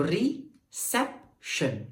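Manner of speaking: "Reception" slowly split, weight on sep, shun dropping away; stress on SEP. Shown as a demonstration. Teacher showing where energy rises, "reception" stressed on sep. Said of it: "सेप में मेरी energy बढ़ रही है".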